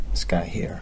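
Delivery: whispered aside at work; hushed so coworkers can't hear. Real, not acted.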